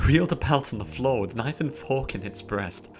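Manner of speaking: ordinary delivery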